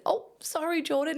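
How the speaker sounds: playfully apologetic; mock apology. Photographer answering Jordan calling her bossy, mock sorry.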